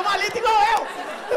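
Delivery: high, loud, dragged-out mock yell; discussão gritada. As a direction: shout it back in a comic counter argument. (grito agudo e arrastado de discussão)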